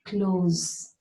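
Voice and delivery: "close" ending in a buzzing Z, not S. Shown as a demonstration. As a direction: say "close" with a voiced Z at the end. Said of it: "like you're closing a door".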